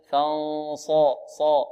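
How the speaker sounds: فانصا slowly, then a full, thick صا; heavy saad. Shown as a demonstration. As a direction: isolate the heavy ص in صا. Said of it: "مفخمة". (نطق صا بصاد مفخمة)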